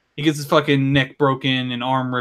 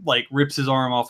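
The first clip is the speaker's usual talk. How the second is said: ordinary talk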